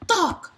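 sharp high thunk; thunk sound. Acted. Reader voicing the racket hit, sharp thunk.

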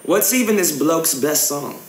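casual fake English accent for a prank call; put-on English accent. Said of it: "gaming in an English accent".

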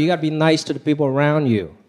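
usual speaking voice

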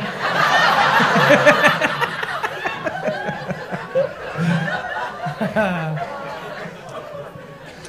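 long wheezing laugh, mostly air; breathy laugh. Real, not acted. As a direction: wheeze with laughter, barely voiced. Laughing at his own joke.

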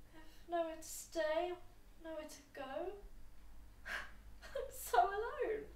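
quiet, slow breaking sobs; crying. Acted. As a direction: fall apart into tears. Breaking down alone.

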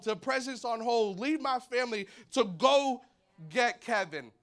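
high, loud driven voice; the father determined to find his boy; urgent searcher. Acted. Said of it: "He's like".

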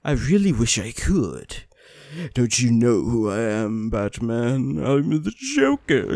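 slow theatrical villain impression; Joker. Player taunting Batman as the villain, slow and drawn-out. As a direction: draw it out as an amateur villain impression. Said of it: "I can't do the Joker".

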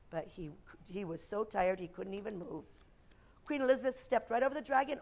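usual speaking voice

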